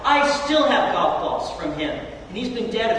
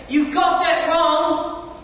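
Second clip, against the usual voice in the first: slow, raised mock shout at the golfer; an old man yelling back, imitated; retold shout back; 7 semitones higher, 4 dB louder, much slower than usual. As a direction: bellow the correction across the tee. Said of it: "I screamed back at him".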